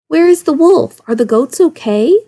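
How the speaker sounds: loud, high-pitched worried cry; alarmed calling. A narrator's reading. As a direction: shout it out of breath. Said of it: "the villagers gasped".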